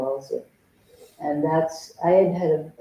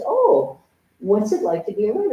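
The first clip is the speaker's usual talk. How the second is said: high sing-song therapist voice; chirpy mock therapist question; imitating the therapist; 6 semitones higher, 4 dB louder than usual. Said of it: "She says".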